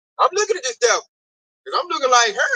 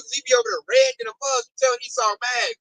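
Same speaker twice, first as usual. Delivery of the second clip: ordinary talk, nothing marked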